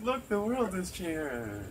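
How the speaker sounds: high, slow, wondering announcement; hopeful exclaim. Retold or imitated. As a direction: marvel at it like a proud elder. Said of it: "We're like".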